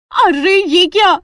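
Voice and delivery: loud, high, drawn-out exclamation of surprise; astonished cry. Acted. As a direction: gasp out your amazement at full voice. Manjari spots the momo counter.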